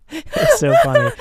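high burst of laughter through the words; laughing. Real, not acted. Husband laughing while telling of the barber. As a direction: laugh it out, can barely speak.

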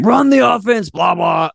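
high-pitched mock sideline yell; coach's yelled order, retold. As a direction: imitate a coach yelling at the team. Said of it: "I'm yelling and screaming at the team".

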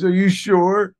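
high, loud suspicious questions; pressing him on the phone; mock doubting girlfriend; doubtful girlfriend. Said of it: "she goes".

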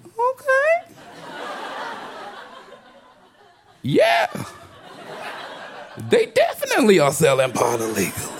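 high, mock-polite inner voice that turns blunt; sweet on top, fed up underneath; a customer silently telling the loudmouth to shut up. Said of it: "that's what I said in my head".

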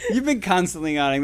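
ordinary delivery

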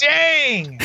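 high, drawn-out cry of disappointment; dismayed exclamation. Real, not acted. Colleague learns the records are gone, high dismayed exclamation. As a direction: stretch it out high, then let it fall. Reacts to records being given away.

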